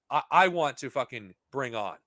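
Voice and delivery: ordinary delivery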